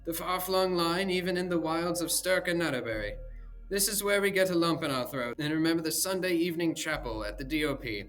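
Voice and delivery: mock the old-school sentiment; mocking Devine.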